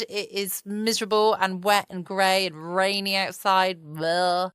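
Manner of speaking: usual speaking voice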